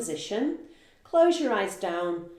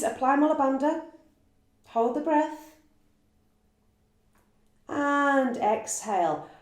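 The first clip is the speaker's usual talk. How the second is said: yoga class cueing; 6 semitones higher than usual. Acted. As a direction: call out each cue to the class. Calls out yoga posture cues.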